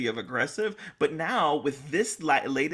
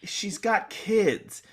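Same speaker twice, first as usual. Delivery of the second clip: usual speaking voice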